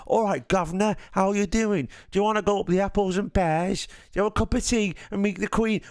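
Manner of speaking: high-pitched, hammed-up Cockney accent; mock English accent. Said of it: "when you all think we all go".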